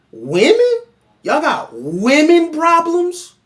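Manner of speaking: high, loud, slow incredulous question; mock disbelief. Real, not acted. Scoffing at men with women problems, mock disbelief. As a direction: act stunned, stretching out "problems". Mocking the other men's complaint.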